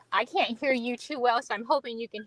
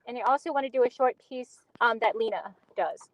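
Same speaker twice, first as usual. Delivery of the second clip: normal talking voice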